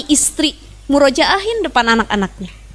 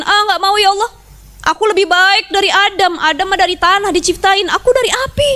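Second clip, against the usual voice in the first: arrogant refusal; 6 semitones higher than usual. Retold or imitated.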